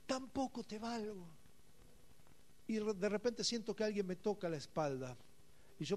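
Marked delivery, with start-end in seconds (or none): 0.0-1.2 soft, hurt murmur to God; a touch of a hurt sigh; broken prayer. Retold or imitated. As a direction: lament quietly, feeling worthless.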